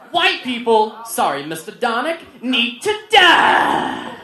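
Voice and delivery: high, loud mock outburst; students' outburst. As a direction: imitate a kid yelling, stretch the last word long. Acting out kids arguing in class.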